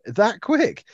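high-pitched disbelief; shocked. Retold or imitated. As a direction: squeak out the shock. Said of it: "You're like".

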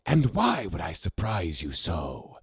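a hint of a serpent hissing back; hissed. A narrator's reading. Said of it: "hissed Saramis".

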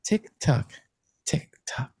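ordinary talk, nothing marked

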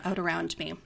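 plain reading voice

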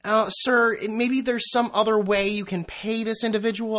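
high, prissy support-agent voice; a lightly hammed-up polite agent; mock customer service rep. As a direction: ape the scripted phone rep. PayPal rep suggests alternative.

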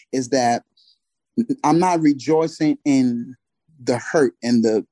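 usual speaking voice

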